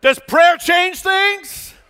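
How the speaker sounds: loud, high, slow preacher's cry; preaching at full force. Acted. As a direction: hammer the question home. Preaching climax.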